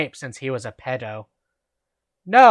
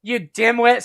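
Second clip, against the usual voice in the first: angry; 7 semitones higher than usual. A narrator's reading. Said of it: "Snape began to shoot angrily".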